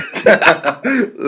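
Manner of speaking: high, loud burst of laughter; real laugh at your own story. Real, not acted.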